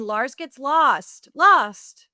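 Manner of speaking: slow title, LOST rising high and loud; exclaimed book title. Acted. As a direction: announce it with mounting alarm, punching the repeat. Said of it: "every time there's more exclamation points".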